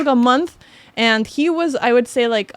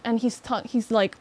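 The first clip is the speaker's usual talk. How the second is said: usual speaking voice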